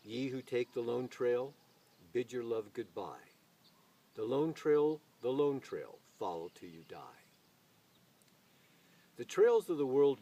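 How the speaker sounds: rolling, dramatic poetry reading with swelling lines; solemn recital of a frontier poem, rising and falling; poem recitation. Acted. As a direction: declaim the verse like a lone traveller's hymn. Recites a Robert Service poem.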